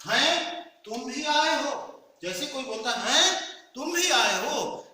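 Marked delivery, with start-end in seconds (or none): 0.1-2.0 high, slow astonished cry; surprised, stretching "हैं"; surprised exclamation. Shown as a demonstration. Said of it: "अगला उदाहरण आपके लिए". 3.1-4.9 high, loud, slow astonished "हैं"; astonished at an unexpected guest; surprised exclamation. Shown as a demonstration. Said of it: "इस तरह से जब बोलता है कोई".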